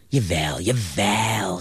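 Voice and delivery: put-on drawn-out, emphatic old man's cry at ordinary volume; insistent exclamation. A narrator's reading. Old man reassuring a doubting Jonathan, emphatic drawn-out exclamation. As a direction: insist theatrically, stretching the second word. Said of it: "riep de oude man".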